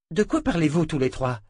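understated exasperation; exasperated. A narrator's reading. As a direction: ask it with a touch of impatience. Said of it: "demanda Jensen exaspéré".

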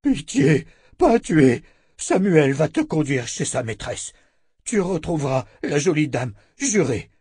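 high, loud creature begging for mercy; terrified monster pleading. A narrator's reading. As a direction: plead in terror, pitch jumping up on the first words. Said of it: "la haine fut aussitôt remplacée par de la terreur".

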